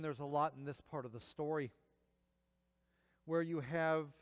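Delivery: ordinary talk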